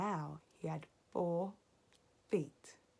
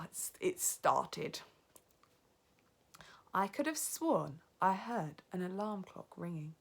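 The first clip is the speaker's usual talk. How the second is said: ordinary delivery, nothing marked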